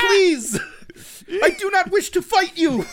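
high, earnest hero's plea, overdone; Ashitaka imploring voice. Acted. Hero refusing to fight the monster, imploring. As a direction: plead loudly for peace in a high voice. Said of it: "that Ashitaka imploring voice".

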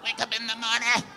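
very high, put-on chanting cry; high mimicking voice. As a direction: repeat it in a silly high imitation.

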